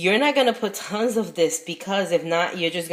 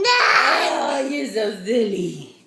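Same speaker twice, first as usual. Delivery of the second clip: laughing; 5 semitones higher, much slower than usual. Real, not acted. Laughing at the baby.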